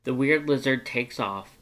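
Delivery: ordinary delivery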